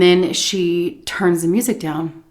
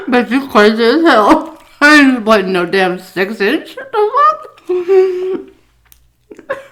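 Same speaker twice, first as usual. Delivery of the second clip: loud retort; 6 semitones higher, 6 dB louder than usual. Real, not acted. Talking back to the absent boss.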